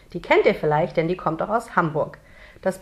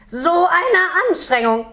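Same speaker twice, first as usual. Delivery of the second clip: high, loud, drawn-out complaint from a straining little creature; groaning effort; 10 semitones higher, 4 dB louder, slower than usual. A narrator's reading. Smoke creature complains while taking shape, groaning effort. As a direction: moan loudly about the sheer effort. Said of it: "dabei ächzt und stöhnt er".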